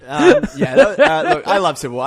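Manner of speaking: high, loud laugh breaking through the words. Real, not acted. Host cracking up at his own Thor joke, laughing. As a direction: giggle your way back into the point.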